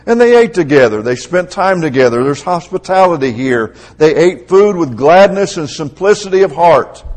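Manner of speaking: high, loud preaching voice; fervent preaching. Acted. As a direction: proclaim it to the whole congregation with force. Preacher proclaims the early church example.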